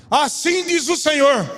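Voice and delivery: high, slow imitation of a prophesying pastor; imitated pastor delivering God's word; prophetic proclamation. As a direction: proclaim it from the pulpit, pitched high and drawn out.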